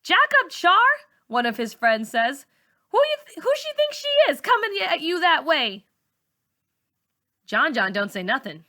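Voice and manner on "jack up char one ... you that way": high, loud scoff; mocking. A narrator's reading. John John's friend scoffing at Char, loud and mocking. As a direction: needle her with a laugh in it.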